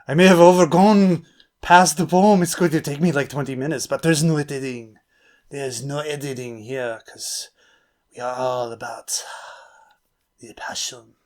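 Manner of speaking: halting, hammed-up French accent. Shown as a demonstration. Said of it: "my French accent".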